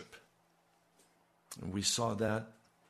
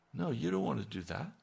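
ordinary talk, nothing marked

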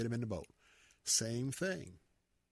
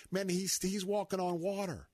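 ordinary delivery

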